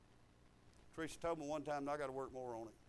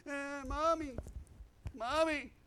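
mock whiny child; 9 semitones higher, slower than usual. Mocking grown son calling mother.

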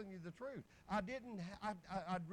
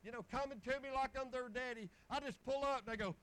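usual speaking voice

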